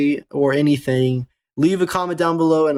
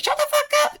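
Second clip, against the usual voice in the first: mocking retort. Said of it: "You're like".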